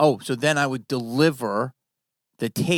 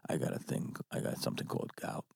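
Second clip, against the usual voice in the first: put-on low, soft, muttered old-timer pitch; hint of a tired veteran agent winding down; quiet low mock old agent voice; lightly voiced old agent; 9 semitones lower, 7 dB quieter than usual. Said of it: "And I always said".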